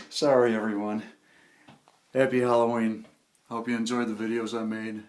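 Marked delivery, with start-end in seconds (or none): 0.1-3.0 loud, cheery sign-off; cheerful shout. Real, not acted. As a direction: call it out to everyone.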